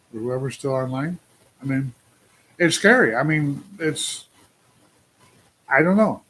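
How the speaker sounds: ordinary talk, nothing marked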